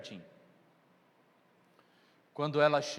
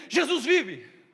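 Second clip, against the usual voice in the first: loud, slow joyful proclamation; triumphant shout; 7 semitones higher, 9 dB louder, slower than usual. Acted. Mary telling the disciples, triumphant shout. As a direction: shout the news slowly, full of joy.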